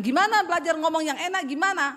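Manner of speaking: wife protesting loudly, asking how to speak nicely; exasperated. Retold or imitated. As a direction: throw the question back in frustration. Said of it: "Saya bilang".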